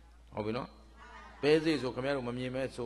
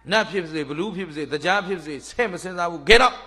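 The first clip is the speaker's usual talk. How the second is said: loud, emphatic refusal building to the end; mock-stern storyteller's rebuff; acted dismissal; 3 semitones higher, 7 dB louder than usual. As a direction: throw the suitor out with a raised voice. Princess dismisses deity in story.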